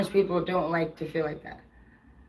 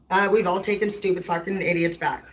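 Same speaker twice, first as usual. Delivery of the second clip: ordinary talk, nothing marked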